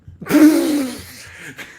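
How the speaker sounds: sudden loud cackle; real loud laugh; loud high burst of laughter. Real, not acted. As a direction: howl with laughter at the punchline.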